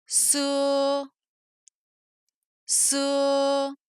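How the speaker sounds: "ce" as one long level syllable; drawn-out ce, twice, for repeating; whole syllable drill. Shown as a demonstration. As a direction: hold the e of ce on an even pitch. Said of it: "en prononçant d'abord la syllabe entière deux fois".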